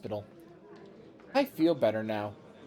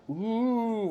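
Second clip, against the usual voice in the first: drawn-out ghostly ooh; playful spooky wail; 8 semitones higher than usual. Acted. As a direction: stretch it out like a mock ghost. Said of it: "You hear a spooky voice".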